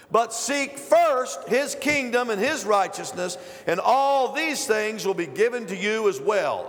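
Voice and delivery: slow, loud, measured reading of scripture. Acted. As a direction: lead the congregation through the verse, slowly. Reads scripture aloud with congregation.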